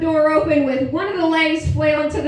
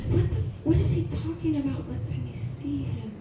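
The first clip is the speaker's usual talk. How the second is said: hushed, baffled questioning, fading softer; puzzled aside; 7 dB quieter than usual. Retold or imitated. Wife alone in bathroom wondering what husband means, hushed bafflement. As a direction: keep it under your breath, confused. Muttered puzzlement behind closed door.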